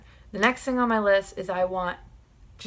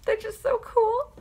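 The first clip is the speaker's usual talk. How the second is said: squealing much higher with delight; a fangirl squeal over dinosaurs; gushing squeal. Real, not acted. Fan gushing to viewers about dinosaurs, high squeal.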